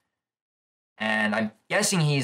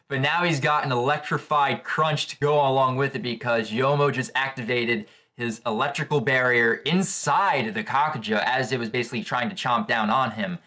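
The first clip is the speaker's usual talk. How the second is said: normal talking voice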